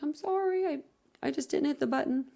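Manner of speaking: high, small apologetic voice; mock apologetic. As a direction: act out a sheepish sorry. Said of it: "and I was like".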